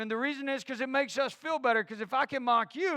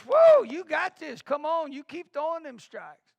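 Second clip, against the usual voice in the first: encouraging coach; 6 semitones higher, 6 dB louder than usual. Shown as a demonstration. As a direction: hype up a young pitcher. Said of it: "See, body language".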